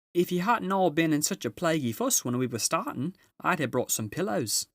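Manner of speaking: a fretful beaver mother grumbling about pillows; fussy Mrs Beaver. A narrator's reading. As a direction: scold them lightly for the fuss, high and busy. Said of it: "said Missus Beaver".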